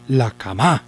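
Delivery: "cama" mis-stressed as ca-MÁ; light ca, rising stressed MÁ; stress on last syllable. Shown as a demonstration. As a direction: lean on the final MA of "cama". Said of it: "Suponemos que es ma".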